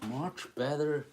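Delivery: usual speaking voice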